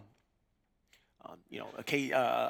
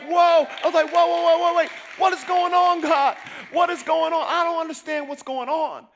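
stunned shout; 13 semitones higher, 11 dB louder than usual. Retold or imitated. Amazed at salvations.

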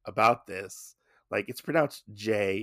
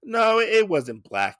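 high, loud, caught-out backpedal; flustered denial; 7 semitones higher, 6 dB louder than usual. Retold or imitated. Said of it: "he's like".

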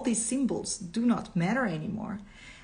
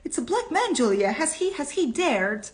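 high-pitched, stammering outrage; scandalized, pitch jumping well up; snarled; 8 semitones higher, 4 dB louder than usual. A narrator's reading. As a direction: sputter it in acted indignation. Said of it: "he snarled".

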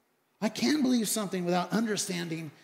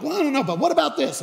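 higher, louder, quick protest; retold objection; 7 semitones higher, 6 dB louder, faster than usual. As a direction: push back with a hurried excuse. Retold excuse-making questions.

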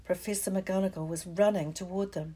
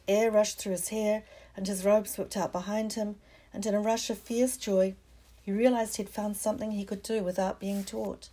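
ordinary delivery

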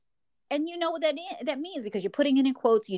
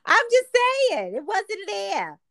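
playful protest; 10 semitones higher than usual. Real, not acted. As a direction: insist on it, voice jumping up. Repeats teasing protest, louder and higher.

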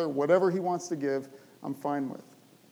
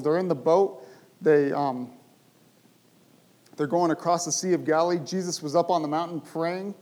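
ordinary talk

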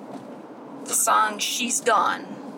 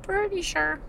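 pitched way up, mocking; high teasing echo; 11 semitones higher, 3 dB quieter than usual. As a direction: squeak it back playfully. Repeated high, far voice.

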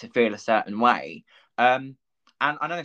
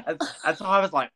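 laughing; 9 semitones higher, faster than usual. Real, not acted.

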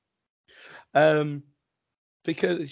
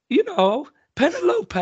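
high, louder mimic of the wife insisting; mock wife; 7 dB louder than usual. Husband voicing his wife insisting on the name, high and loud. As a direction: act out the wife insisting, pitched up. Wife voiced in argument story.

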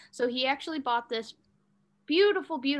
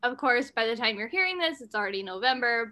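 ordinary talk, nothing marked

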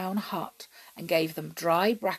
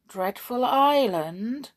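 slow, higher, uneasy echo; uneasy brownie, drawn out and raised; uncomfortable; 5 semitones higher, 6 dB louder, slower than usual. A narrator's reading. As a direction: query it with worried emphasis. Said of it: "feeling rather uncomfortable".

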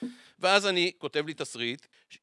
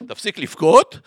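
loud self-scolding; 7 semitones higher, 12 dB louder than usual. Retold or imitated. Teacher scolds himself for crying.

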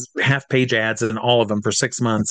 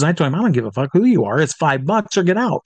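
quick, higher-pitched imitation of a no-nonsense mom; feisty farm-woman mom; 4 semitones higher than usual. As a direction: play mom laying down the law at the door. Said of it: "my mom's an old".

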